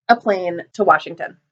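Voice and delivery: usual speaking voice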